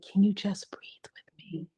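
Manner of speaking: soft slow whisper. Retold or imitated. Therapist soothing a crying child, slow whisper. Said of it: "if I've said".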